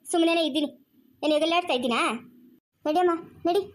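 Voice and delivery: ordinary talk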